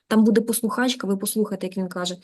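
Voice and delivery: ordinary talk, nothing marked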